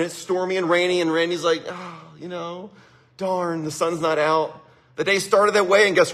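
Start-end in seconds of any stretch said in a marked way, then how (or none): 1.7-4.6 slow, soft mopey sigh; mock dejected. Recalling waking up to a stormy, rainy day, mildly dejected. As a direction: drag it out, deflated, imitating a gloomy morning. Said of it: "when I was feeling down".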